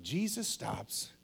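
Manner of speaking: usual speaking voice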